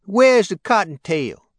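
high, loud and slow, demanding; a girl's loud, high-pitched demand; loud young woman. Acted. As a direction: call out for Cottontail impatiently. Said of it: "talking to him in a loud voice".

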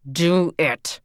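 firmer command. A narrator's reading. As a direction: insist harder the second time. Father repeats the order louder.

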